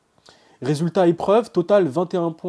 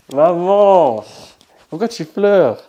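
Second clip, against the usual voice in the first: calling out; 4 semitones higher, 10 dB louder, much slower than usual. Real, not acted. Mother crying at his news.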